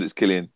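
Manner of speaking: Cillian said as KIL-ee-an; hard C pronunciation. Shown as a demonstration. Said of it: "it's not a Tolkien word".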